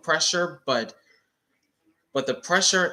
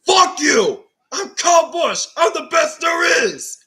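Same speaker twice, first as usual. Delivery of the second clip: high, loud shouted parody; Kyle Busch impression; 9 dB louder than usual. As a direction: yell it like a cocky driver mocked. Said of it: "he goes into his Kyle Busch impression".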